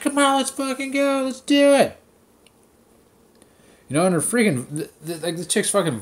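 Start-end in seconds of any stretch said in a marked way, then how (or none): 0.0-2.0 high, pumped-up imitation of the instructor; mock cheery instructor. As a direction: hype the class like an annoying coach, pitched way up. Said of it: "This chick, she's like".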